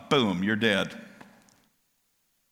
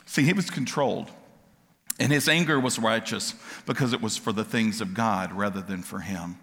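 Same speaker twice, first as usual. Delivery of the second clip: ordinary talk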